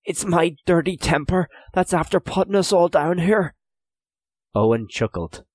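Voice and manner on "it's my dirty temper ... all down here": high, earnest self-blame; remorseful confession. A narrator's reading. Prisoner takes the blame before cellmates, remorseful confession. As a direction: own up loudly to your cellmates. Hugh apologises aloud to cellmates.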